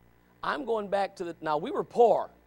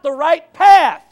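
much louder and slow, each word hammered; a rising, forceful preacher's climax; emphatic; 7 semitones higher, 15 dB louder, much slower than usual. Real, not acted. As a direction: drive the final words home. Preacher hammers home his point.

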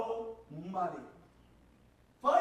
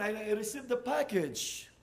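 usual speaking voice

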